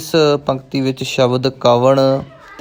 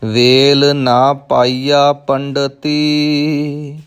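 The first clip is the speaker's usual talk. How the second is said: slow verse reading with long-held line endings; measured Gurbani recitation; scripture recitation; much slower than usual. Shown as a demonstration. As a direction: recite each phrase deliberately, stretching the last vowel.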